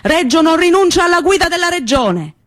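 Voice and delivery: high, ringing rally shout from the podium; mayor shouting. Retold or imitated. Mayor shouting to the piazza crowd, high rally shout. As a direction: proclaim it to the crowded square. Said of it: "Il sindaco gridava".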